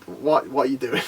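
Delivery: low, soft, unbothered question; deadpan puzzlement. Acted. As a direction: ask it mildly baffled, voice dropped. Acts Saitama's unbothered puzzlement.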